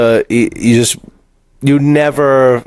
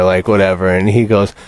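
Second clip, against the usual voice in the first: normal talking voice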